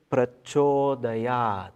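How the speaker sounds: "Prachodayat" slowly, the C as CH. Shown as a demonstration. Teacher correcting how romanized C is read, "Prachodayat" with CH. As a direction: draw out "Prachodayat" with a clear CH in "cho". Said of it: "is always pronounced as Ch".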